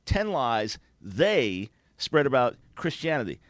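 They set apart and drawn out; slow title reading, leaning hard on They; stress on They. Real, not acted. Host challenging the author's title, stress on They.

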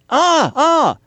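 high-pitched feigned eureka; mock realization. Host pretends to solve his own easy riddle, mock realization. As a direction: burst out as if the answer just dawned. Pretends the answer suddenly dawns.

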